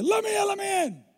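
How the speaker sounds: bang-on-the-door demand, hammed up; mock shout. Husband banging on wife's window.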